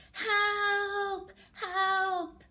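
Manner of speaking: high, drawn-out mock shout; calling for help. Story character waving arms for rescue, mock shout for help. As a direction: call out for rescue, playfully. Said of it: "shout for help".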